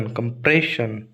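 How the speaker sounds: slow compression, the pray drawn out; stress on pray. Shown as a demonstration. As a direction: stretch PRAY in compression. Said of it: "pray पर आएगा शन". (Compression का pray खींचकर)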